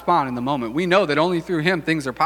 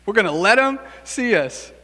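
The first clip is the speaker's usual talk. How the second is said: usual speaking voice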